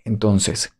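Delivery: ordinary talk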